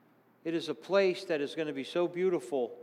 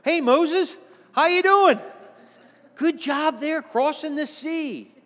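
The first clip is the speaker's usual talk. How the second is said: high, loud, delighted hail; bright shout of welcome, slowing at the end; cheery greeting to Moses; 12 semitones higher, 10 dB louder, slower than usual. Pretend. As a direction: call out to an old friend across the room. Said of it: "walking over and going".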